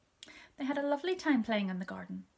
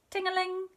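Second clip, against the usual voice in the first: bicycle bell ring; 11 semitones higher, 5 dB louder than usual. A narrator's reading. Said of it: "said Mister Jones's bicycle bell".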